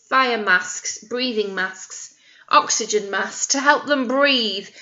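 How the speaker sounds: ordinary delivery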